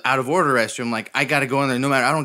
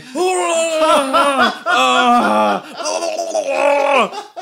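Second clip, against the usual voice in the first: long, high, loud scream; mock charge yell at full volume; screaming battle cry; 11 semitones higher, 5 dB louder than usual. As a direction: holler a wild, wavering war cry. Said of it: "I'm screaming".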